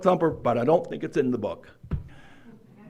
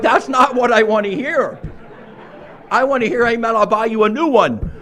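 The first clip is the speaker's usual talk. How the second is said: higher, louder retold complaint with a laugh; mock exasperation; 8 semitones higher, 7 dB louder than usual. Answering my friend's bus offer, exasperated and laughing. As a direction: let the disbelief spill out, half laughing.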